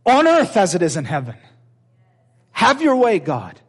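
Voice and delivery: driving, emphatic pulpit delivery; impassioned preaching. Real, not acted. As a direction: preach it out to the whole room. Preaching at full force.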